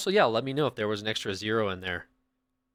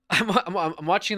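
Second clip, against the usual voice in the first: flustered laugh; 7 semitones higher, 6 dB louder than usual. Real, not acted. Laughing after the donation.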